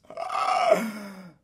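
drawn-out acted "ah" cry; demon's cry. Demon in the synagogue, crying out wordlessly. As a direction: cry out like a tormented spirit. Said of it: "This deep cry comes out".

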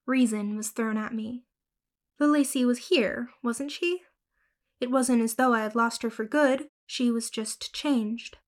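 ordinary delivery, nothing marked

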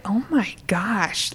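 breathy hushed "oh my gosh", drawn out; slow, voiceless gasp of awe; shocked whisper. Retold or imitated. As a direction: whisper it to yourself in disbelief. Remembered shocked reaction while watching.

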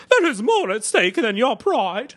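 high, slow, hammed-up posh British Queen; a queen scolding a knight in exaggerated British tones; over-the-top British accent. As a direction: overdo a regal British accent, stretching every word. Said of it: "in the most over-the-top British voice ever".

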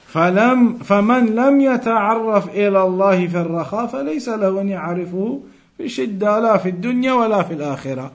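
quick, steady reading of the Arabic text; Arabic text recitation. Acted. Teacher reads the hadith text to students, in a brisk recitation. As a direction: rattle through the quoted Arabic like recitation.

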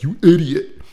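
self-scolding. Retold or imitated. Man walking away from a chat, self-scolding. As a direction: berate yourself in one sharp burst. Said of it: "saying to myself".

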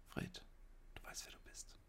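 breathy whisper. Pretend. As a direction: whisper it to Fred. Whispered aside.

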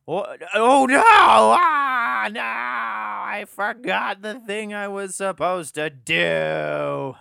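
high, loud mock bawl of dismay; hammed-up kid meltdown, stretched vowels; dumb kid wailing. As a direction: howl in panic, then drag out the confession like a crying child. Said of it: "some dumb motherfucking kids".

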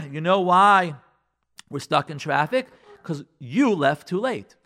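loud, accusing spouse; straight-out blame. Acted. Spouse blaming partner for the failed trip, accusing. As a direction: hammer out each accusation. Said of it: "it's straight out blame".

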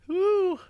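high-pitched "woo" whoop. Real, not acted. Cohost as the burn keeps building, whooping.

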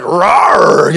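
loud imitated predator roar; long, loud lion-like roar. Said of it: "like a predator hunting you down".

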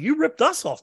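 high, heated mock yell at the promoter; retold angry outburst. Yelling at Chris over the lost show, in a mock shout.

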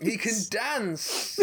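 high, slow grand call; panto host announcement. Acted. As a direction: proclaim it to the crowd.

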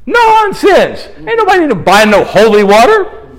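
high, indignant exclamation; scornful outburst. Real, not acted. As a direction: throw it out high, dismissing it outright. Scoffing at selling holy water.

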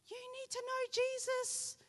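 high, slow, earnest voice of the changed friend; imitating eager friend. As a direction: lift it up high and slow, full of conviction. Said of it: "But now she's like".

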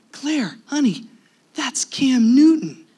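high, excited stage whisper; starstruck. Retold or imitated. Husband tells wife who the celebrity is, starstruck.

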